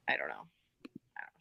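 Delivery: unsure whisper. Real, not acted. Reader torn about a character, whispered doubt. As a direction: whisper it as if thinking aloud.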